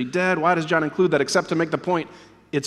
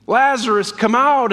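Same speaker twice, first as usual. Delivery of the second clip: higher, louder commanding call; re-enacted shout; 4 semitones higher, 3 dB louder than usual. Preacher re-enacting Jesus summoning Lazarus, raised call. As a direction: call the dead man out firmly. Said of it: "Jesus shouts".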